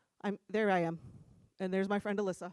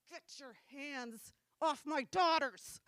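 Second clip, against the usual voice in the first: slow, higher, restrained menace; imitating gritted-teeth fury; 4 semitones higher, slower than usual. Mom confronts dudes touching girls, seething slowly. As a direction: force each word through gritted teeth. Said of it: "she grits her teeth".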